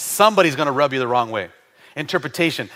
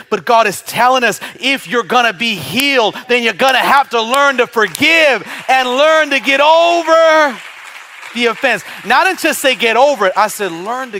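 higher and louder rising sermon push; full-force preaching; 7 semitones higher, 7 dB louder than usual. Acted. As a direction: drive it home to the congregation.

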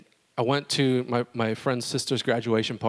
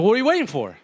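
eager challenge; 9 semitones higher, 5 dB louder than usual. Retold or imitated. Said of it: "I said".

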